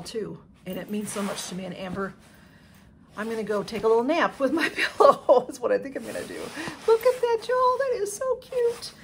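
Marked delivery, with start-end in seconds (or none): 3.2-6.4 loud, quick, laughing as she talks; real gleeful laughter breaking in; delighted laughing. Real, not acted. Delighted joke about gifted pillow. 6.9-8.9 very high, loud gushing; high-pitched, loud delight over something cute; delighted squeal. Real, not acted.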